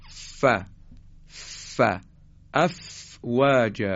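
short airy puffs of «ف», then a slow «افواج»; careful demo of Arabic F in «افواج»; teeth-on-lip F. Shown as a demonstration. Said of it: "دندانهای بالا میان روی لب پایین".

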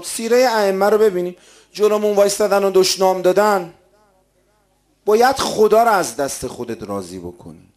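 emphatic preaching. Real, not acted. Preacher urging listeners to please God, forceful. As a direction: drive each point home to the congregation. Exhorts the congregation.

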